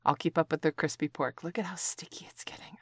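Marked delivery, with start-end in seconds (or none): 1.4-2.8 soft excited whisper. Acted. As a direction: whisper it close. Admiring the pork.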